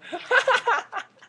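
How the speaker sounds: high, loud burst of laughter. Real, not acted. Vlogger bursting out laughing on realising the camera was recording. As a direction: let a big laugh explode.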